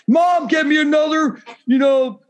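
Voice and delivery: mock whiny shout of a grown man at home; mocking basement troll. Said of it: "in the fucking basement of his mom's house".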